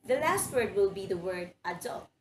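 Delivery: usual speaking voice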